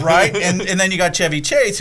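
ordinary talk, nothing marked

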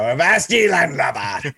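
a light put-on pirate. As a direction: growl it like a cartoon pirate, half laughing. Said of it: "a guy with an eye patch".

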